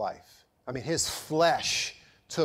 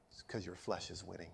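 much softer and lower, a hushed inner voice; gentle whisper; 9 semitones lower, 17 dB quieter than usual. Retold or imitated. The Spirit answers the pastor in prayer, gentle whisper. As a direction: let it fall to a near whisper. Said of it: "in that gentle whisper".

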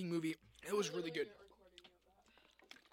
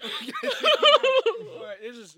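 loud laugh breaking to tears; 5 semitones higher, 11 dB louder, much slower than usual. Real, not acted.

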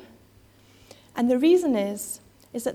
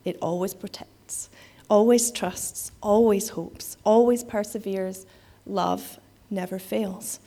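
big poetic finish; 2 semitones lower, slower than usual. Shown as a demonstration. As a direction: build it to a grand finale, then let it land. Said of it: "This is the big finish".

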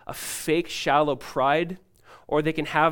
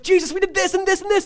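mock excited brag, much higher; pumped disciples; 6 dB louder than usual. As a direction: squeal it like thrilled kids showing off. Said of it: "they're just pumped".

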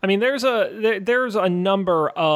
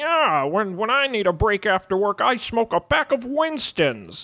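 imitating Fred Flintstone; 5 semitones higher than usual. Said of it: "aired back in Fred and Barney".

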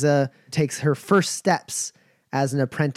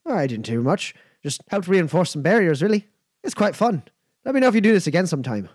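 higher Giles voice, rushed at end; 3 semitones higher, faster than usual. Acted.

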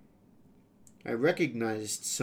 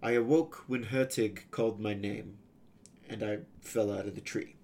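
plain reading voice

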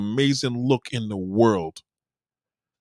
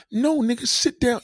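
high commanding; 12 semitones higher than usual. Retold or imitated. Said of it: "acting out confrontation".

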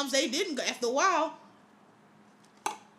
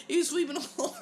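ordinary delivery

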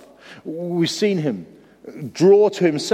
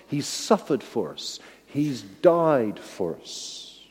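solemn and soft; 3 semitones lower, 6 dB quieter, much slower than usual. Acted. Solemn climax on Jesus' suffering.

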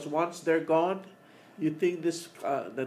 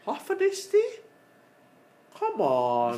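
shocked disbelief; 8 semitones higher, 5 dB louder, slower than usual. Retold or imitated. Quoting shocked reaction to word.

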